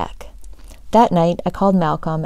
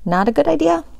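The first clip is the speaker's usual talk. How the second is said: friend's voice; 9 semitones higher, faster than usual. A narrator's reading.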